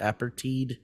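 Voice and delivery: APARTHEID ending like TEED; questioning pronunciation. Shown as a demonstration. Host unsure what it is, APARTHEID ending in "teed". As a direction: softly try APARTHEID as "apper-TEED". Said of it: "or what is it?".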